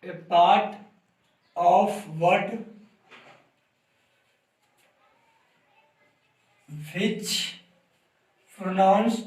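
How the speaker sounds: word by word, with long gaps; slow, halting write-along reading; slow dictation. Real, not acted. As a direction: dictate slowly as you write it out. Dictating definition while writing it out.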